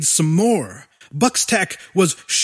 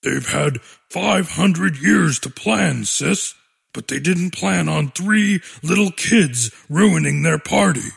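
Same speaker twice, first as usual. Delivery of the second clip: put-on calm monster boy voice; 3 semitones lower than usual. Dexy, slow, low.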